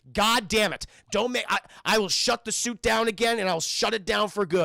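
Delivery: loud, irritated warning; mock angry threat. Streamer voicing Bruce threatening to shut the suit down, angry. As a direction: snap it as an exasperated ultimatum.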